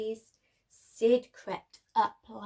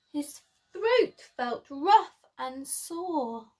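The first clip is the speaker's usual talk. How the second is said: usual speaking voice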